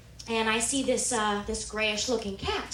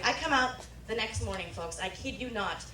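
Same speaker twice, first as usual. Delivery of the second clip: usual speaking voice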